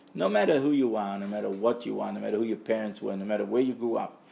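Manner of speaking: normal talking voice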